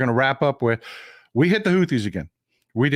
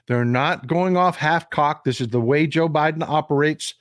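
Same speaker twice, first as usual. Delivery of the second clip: usual speaking voice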